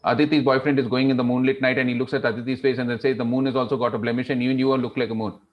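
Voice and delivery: rushed, run-on storytelling; fast. Shown as a demonstration. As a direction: rattle through the romance without a pause. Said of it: "Suppose I go fast".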